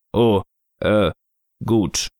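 low, halting reply; disconcerted Snow Father. Acted. Snow Father embarrassed by the child's remark, low halting voice. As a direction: hesitate awkwardly in a low voice.

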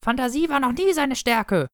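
hoch und spöttisch; verhöhne den Bruder mit hoher Stimme. Acted.

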